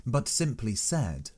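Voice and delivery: ordinary delivery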